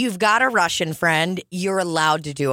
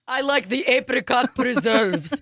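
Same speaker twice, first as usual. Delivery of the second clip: higher-pitched mock Russian accent; 5 semitones higher than usual. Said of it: "It's very Russian".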